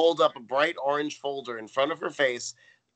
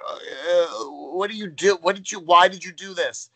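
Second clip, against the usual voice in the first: higher, stammering incredulous demand; mock exasperation; 5 semitones higher, 5 dB louder than usual. Natalie confronts Tootie under the sheet, mock-exasperated demand. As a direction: demand an explanation, hammed-up and flustered.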